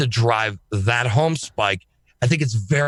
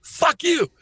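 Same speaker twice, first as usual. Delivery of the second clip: gleeful defiance. Retold or imitated.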